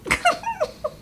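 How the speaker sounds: high burst of laughter. Real, not acted. Vlogger laughing at the book's ending, high burst of laughter. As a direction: laugh out in disbelief.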